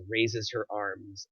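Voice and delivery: usual speaking voice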